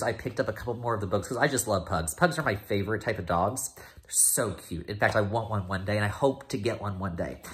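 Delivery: usual speaking voice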